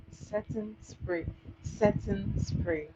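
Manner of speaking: slow, spaced-out "Setting spray"; drawn-out "Setting spray", as if teaching vocabulary; teaching the term. Shown as a demonstration. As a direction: say "Setting spray" very slowly, word by word, for a learner. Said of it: "Learn the term".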